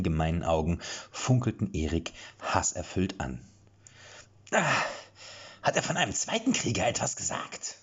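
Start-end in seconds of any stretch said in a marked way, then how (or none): 4.5-7.8 high reedy squawk asking his comrade. A narrator's reading. Jan asking his comrade about a second warrior, squawking. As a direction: squeak out the question like a rodent. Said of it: "mit einer quäkenden Stimme".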